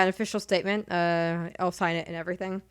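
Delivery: normal talking voice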